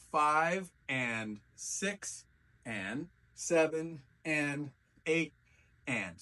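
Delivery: slow, drawn-out five and six and seven and eight; walk them through the counts slowly. Shown as a demonstration. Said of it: "slow counting walkthrough".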